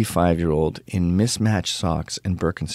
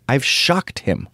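ordinary delivery

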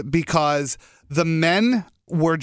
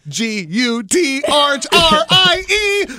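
mock dramatic; 8 semitones higher, 5 dB louder, much slower than usual. Mock spelling the name dramatically.

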